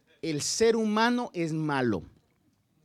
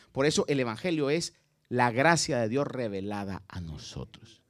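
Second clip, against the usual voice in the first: ordinary talk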